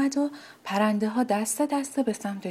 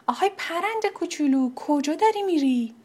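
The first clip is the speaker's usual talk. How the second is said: much higher, kindly "آهای پرند کوچولو" sung out; friendly calling out; 8 semitones higher, 4 dB louder than usual. Acted. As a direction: wave the bird over with a kind call. (صدا زدن بلند و مهربون «آهای پرند کوچولو»)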